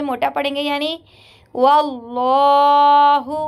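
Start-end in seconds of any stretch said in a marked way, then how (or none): 1.5-3.4 वल्लाहु with a heavy, full lam, recited slowly and louder; tajweed recitation of वल्लाहु, heavy lam. Shown as a demonstration. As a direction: draw out वल्लाहु with a thick lam. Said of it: "अल्लाह के लाम को पूर पढ़ेंगे".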